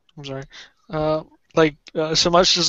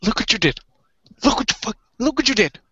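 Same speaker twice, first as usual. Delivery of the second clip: high-pitched exasperated reproach; acted scolding; 6 semitones higher, much faster than usual. Character blaming another for the splintered torch, scolding.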